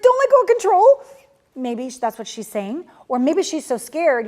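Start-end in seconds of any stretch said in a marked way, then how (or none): 0.0-1.0 much higher, louder panicked little voice; frightened child pleading to hold control; terrified inner child. Retold or imitated. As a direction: cry it out like a scared little girl clinging on. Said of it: "your terrified little one inside".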